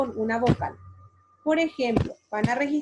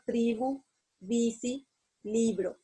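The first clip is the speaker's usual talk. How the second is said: "tribu", "bici", "libro", each stressed on its first syllable; teacher's stress demo of "tribu", "bici", "libro", first syllable stressed; penultimate stress; 4 dB quieter, slower than usual. Shown as a demonstration. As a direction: say "tribu", "bici", "libro" one by one, leaning on the next-to-last syllable. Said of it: "¿Dónde estoy acentuando? En la penúltima sílaba".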